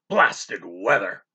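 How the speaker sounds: put-on low, slow growl of an old captain. A narrator's reading. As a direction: grumble it deep and slow. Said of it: "he growled".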